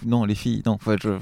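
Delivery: usual speaking voice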